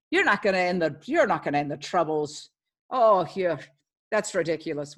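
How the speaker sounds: Irish brogue. Retold or imitated. Northern Irish men mocking the housewives, light Irish brogue. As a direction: scoff at them in a lightly voiced Irish brogue. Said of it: "I guess I could do a brogue a little bit".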